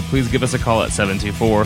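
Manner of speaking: usual speaking voice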